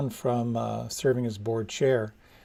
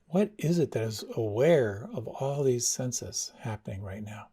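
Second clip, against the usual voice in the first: guided meditation. Acted. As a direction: ease them inward, unhurried. Leads a meditation.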